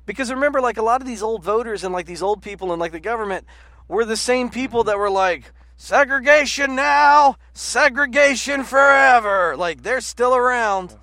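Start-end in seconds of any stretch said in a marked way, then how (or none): 5.8-9.6 slow, higher, louder shouted slogan; mock rally shout. Quoting old segregationist politicians, slow rally shout.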